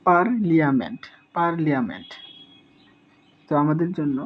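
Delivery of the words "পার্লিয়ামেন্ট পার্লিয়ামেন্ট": পার্লিয়ামেন্ট with the li-a clearly sounded; teacher repeating the memory trick; spelling pronunciation. Shown as a demonstration. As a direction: say পার্লিয়ামেন্ট as it is spelt. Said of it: "এটা যদি আমরা এভাবে বলি".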